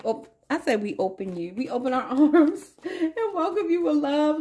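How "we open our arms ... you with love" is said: hammed-up gushing welcome, laughing; over-the-top sweet welcome breaking into a laugh; extra. Shown as a demonstration. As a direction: ham it up, grand and higher. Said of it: "Let me stop being extra".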